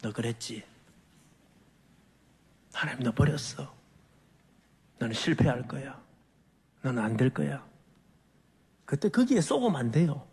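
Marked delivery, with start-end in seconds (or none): 0.0-7.7 low, measured voice of the devil; low taunting devil; accusing devil. Acted. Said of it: "마귀는 우리를 공격합니다".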